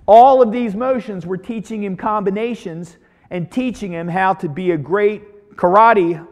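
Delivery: ordinary delivery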